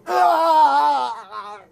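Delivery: high, loud cry of pain as the shock hits; agonized shout; pained scream. Retold or imitated. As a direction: scream out as if electrocuted. Electricity sent to Max.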